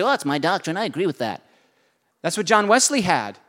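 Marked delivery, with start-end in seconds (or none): 0.0-1.4 mock complacent. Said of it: "sign off on and say".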